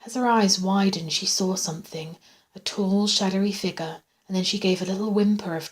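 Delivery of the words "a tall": plain reading voice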